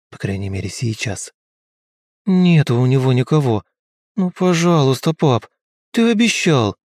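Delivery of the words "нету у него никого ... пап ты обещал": put-on begging child. A narrator's reading. Boy begging father for kitten.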